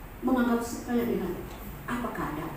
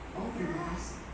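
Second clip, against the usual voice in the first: much softer, breathy-sounding gratitude; hushed thanks; 5 semitones lower, 12 dB quieter than usual. Retold or imitated. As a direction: murmur the thanks in awe. Retold grateful reaction to the money. (ucapan syukur yang lirih)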